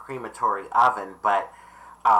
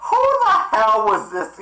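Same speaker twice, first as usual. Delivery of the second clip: high, laughing question; laughing disbelief; 8 semitones higher, 4 dB louder than usual. Real, not acted. Laughing with her mom about the woman, high and amused. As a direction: giggle through the outrage. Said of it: "my mom laughs about it".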